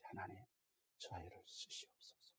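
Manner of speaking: hushed, breathy whisper of a prayer. Retold or imitated. Parent praying silently as the child turns the corner, whispering. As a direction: pray under your breath so no one hears.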